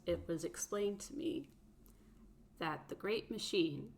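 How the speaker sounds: soft, even android speech; quiet measured robot voice; calm robot voice. Acted. Player voices robot Drum calmly.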